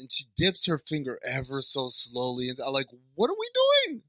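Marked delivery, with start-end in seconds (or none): 3.1-4.0 very high-pitched exasperated question; exasperated outcry. Retold or imitated. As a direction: protest it in a high squeal of disbelief. Exasperated reaction to slow scene.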